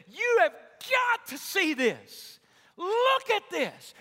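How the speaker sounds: loud, slow, high astonished shout. Retold or imitated. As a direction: shout so everyone turns to look. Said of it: "It's like John is saying this".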